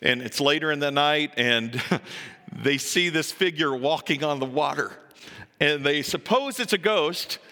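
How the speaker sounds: ordinary talk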